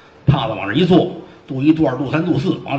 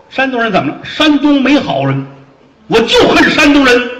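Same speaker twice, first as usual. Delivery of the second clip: building from firm to high, loud shout; rising outburst; 10 semitones higher, 6 dB louder than usual. Acted. Fat officer raging about Shandong men, building to a shout. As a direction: explode with hatred.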